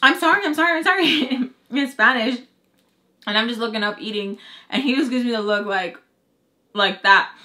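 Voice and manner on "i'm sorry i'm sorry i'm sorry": high, louder rushed sorrys; panicked apology. Retold or imitated. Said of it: "Down comes cheese".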